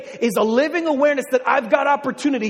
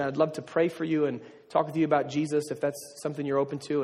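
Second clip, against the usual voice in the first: sheepish, softer apology; awkward; 7 semitones lower, 7 dB quieter than usual. Retold or imitated. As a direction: fumble through an awkward offer. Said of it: "like an awkward dude".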